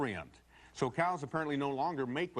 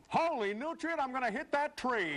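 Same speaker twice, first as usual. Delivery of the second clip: high, pitched-up yelp of alarm; mock panicked yell; 11 semitones higher than usual. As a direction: yell it as you lose control on the slope. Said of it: "how about yelling".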